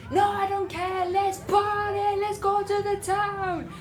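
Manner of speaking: high, stretched-out defiant party cry; retold drunken yelling. Drunk friend ignoring a plea for calm, high yelling. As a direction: imitate a tipsy friend refusing to quieten. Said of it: "take it down a notch".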